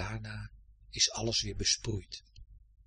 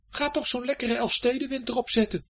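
high, insistent call; exasperated urging; 13 semitones higher, 3 dB louder than usual. Acted. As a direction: urge them on, out of patience. Herfst keeps ordering Winter out. (hoog en ongeduldig aansporend)

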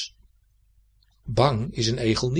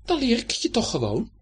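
much higher, eager cat calling out; enthusiastic; 11 semitones higher, faster than usual. A narrator's reading. Cat eagerly offering to teach the hedgehog, enthusiastic. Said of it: "riep Dibbes enthousiast".

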